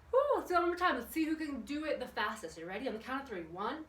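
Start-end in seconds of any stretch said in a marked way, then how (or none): 0.1-1.0 high, loud "woo" of joy; excited cheer. Real, not acted. Cheering after YMCA.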